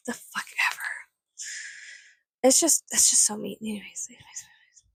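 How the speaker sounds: unvoiced, fading whisper; whispered dismissal. Real, not acted. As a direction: brush it off under your breath. Trailing off after rant.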